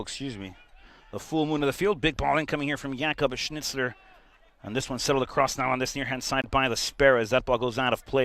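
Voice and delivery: ordinary delivery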